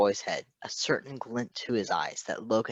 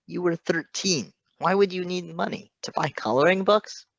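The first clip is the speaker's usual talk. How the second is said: incredulous; 8 semitones higher, 4 dB louder than usual. Acted. Shocked disbelief at the boy.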